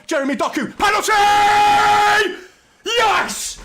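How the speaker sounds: screaming high and very loud, dragging out penalty; ecstatic scream. Real, not acted. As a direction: erupt like the whole stadium. Penalty won, live reaction.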